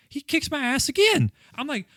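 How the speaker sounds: high, worked-up rant about the boss fight; exasperated rant. Real, not acted. Gamer ranting to his cohost about a boss, worked up. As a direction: pitch climbing as the frustration spills out.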